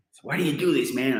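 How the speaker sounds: a pushy photo organiser calling out; American organiser. Retold or imitated.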